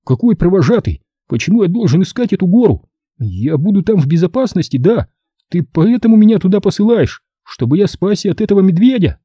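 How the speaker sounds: high-pitched, agitated questioning; distraught boy. A narrator's reading. Boy challenges his father's plan to send him away, high and agitated. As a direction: fire off the questions in rising protest.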